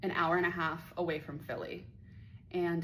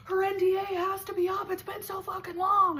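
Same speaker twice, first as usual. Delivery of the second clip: much higher, louder mocking commenter voice; 14 semitones higher, 7 dB louder than usual. Mocking online commenters in a high, louder voice. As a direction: parody the nosy online crowd. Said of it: "biggest comments that I see".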